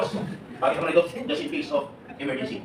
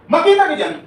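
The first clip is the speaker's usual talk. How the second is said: much higher and louder, calling out; excited callout; 13 semitones higher, 10 dB louder than usual. Real, not acted. Speaker telling the seminar crowd to find his page, excited. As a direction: pitch it up to grab the room.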